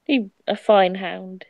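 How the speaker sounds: slow, loud grand greeting; slow doggish speech. Acted. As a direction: speak it slowly like a dog language. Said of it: "he says in doggish".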